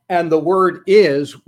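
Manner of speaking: is punched loud; stress on is. Shown as a demonstration. Preacher showing the bold word to the church, stressed. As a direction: hit the word is hard. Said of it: "was in bold capital letters".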